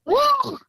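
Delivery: very high creature cry; high-pitched mimicked animal call; imitated alpha howl. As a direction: let out one high, distinct howl like the pack's alpha. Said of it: "you could hear one go".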